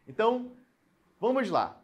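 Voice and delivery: usual speaking voice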